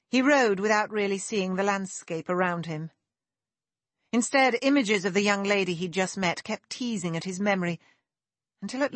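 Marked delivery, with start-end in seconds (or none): none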